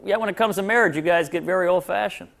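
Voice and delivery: normal talking voice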